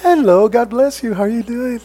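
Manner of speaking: high, sugary greeting to a church friend; sudden church mode. Retold or imitated. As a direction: switch instantly to sweet and pious on the phone. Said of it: "we change all of a sudden into that church mode".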